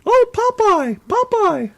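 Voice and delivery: very high squeaky falsetto; cartoon woman voice. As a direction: swoon in a tiny cartoon falsetto. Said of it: "Is that how you like 'em to sound?".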